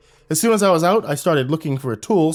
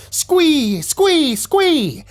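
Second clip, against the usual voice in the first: high shrill spider screeches; creature screeches; 5 dB louder, much slower than usual. Acted.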